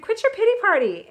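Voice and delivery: loud, high-pitched mock rebuke; rude retold scolding. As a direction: imitate a leader snapping someone out of self-pity. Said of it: "how rude".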